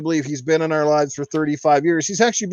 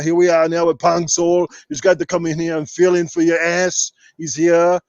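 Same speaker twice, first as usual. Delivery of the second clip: full podcast rant in a held Arnold voice; Austrian-accented action-star voice, kept up line after line; Arnold Schwarzenegger impression. Podcast host answering viewers in character as Arnold, Austrian action-star voice. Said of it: "enough Arnold questions".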